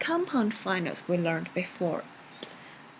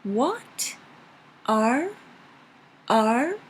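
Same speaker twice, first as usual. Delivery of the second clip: "What" and "R" swept upward; rising tone; 2 semitones higher, 3 dB louder, much slower than usual. Shown as a demonstration. Teacher practising the word for son, rising "R". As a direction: lift "R" like the question "What?". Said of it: "is a rising up tone".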